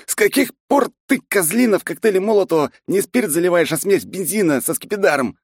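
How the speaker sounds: choking, coughing through words; hoarse coughing fit mid-sentence. Acted. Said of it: "закашлялся".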